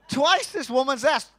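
normal talking voice

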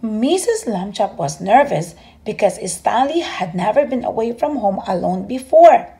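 ordinary delivery, nothing marked

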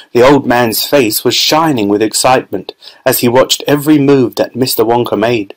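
ordinary delivery, nothing marked

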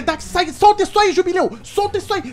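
put-on angry parent yelling at a child; scolding shout. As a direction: bark at the kid to drop it. Scolding kid to drop it.

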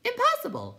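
very high, loud fluttery voice; a flitting light that squeaks; squeaky wisp voice. A narrator's reading. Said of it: "said the Will-o'-the-Wisp".

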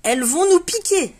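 high mock alarm, a frightened little dog; scared dog. Pipo the dog fleeing the angry bees, mock frightened squeal. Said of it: "en langage chien".

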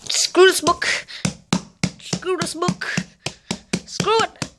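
high-pitched rage at a book; raging. Real, not acted. Vlogger raging at a useless slang book, angry. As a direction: let out a high, exasperated rant. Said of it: "I just raged".

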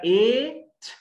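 Eight called out high and loud with a rising tune; teacher pointing out one sound; emphatic rising eight. Shown as a demonstration. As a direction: pop Eight up as a quiz answer. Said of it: "إيه كام صوت طالع؟".

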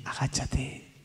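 faint whispered order to bend down; whispered request. Retold or imitated. Bedridden father whispering to his son. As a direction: whisper it weakly from the bed. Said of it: "me dice".

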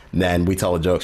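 ordinary talk

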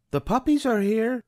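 high thrilled Nanny; excited Nanny. A narrator's reading. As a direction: announce it with delight. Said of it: "Nanny exclaimed".

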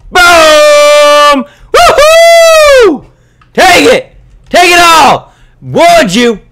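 high, full-volume whooping shout; loud victory yelling. Real, not acted. Gamer celebrating a kill, high triumphant shouting. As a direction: yell in triumph right into the mic. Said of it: "I'm a little close to my mic".

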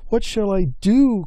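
storybook wail of despair; crying out. A narrator's reading. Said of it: "cried Salmon".

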